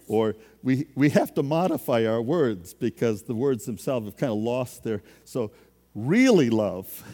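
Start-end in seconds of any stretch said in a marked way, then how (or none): none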